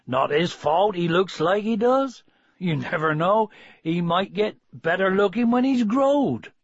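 rustic farmer building emphatically to the end; rustic farmer, defensive. Acted. Farmer defending the puppy he spared to his wife, emphatic.

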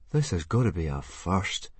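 lightly voiced boy, unimpressed; drawling. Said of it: "drawled Fishlegs".

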